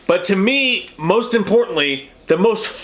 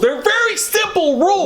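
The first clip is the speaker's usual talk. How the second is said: pitched-up, mock stern; high mock stern voice; 13 semitones higher than usual. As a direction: lecture in a high squeaky voice. Mock stern rule recital.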